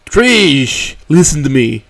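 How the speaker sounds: high, loud, urgent pleading; frantic and worried. Acted. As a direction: grab her attention in a panic. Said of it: "he says with a frantic and worried tone".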